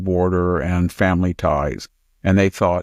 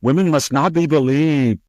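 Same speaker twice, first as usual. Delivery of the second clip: higher put-on voice, sarcastic; mock Senator Hirono; 6 semitones higher than usual. Host throws the senator's slogan back at her, higher mocking voice. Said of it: "you probably said".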